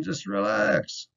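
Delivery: high, soft, slow just relax; cheeky brush-off. Pretend. Student coolly telling the teacher to relax, soft and slow. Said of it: "Tell him".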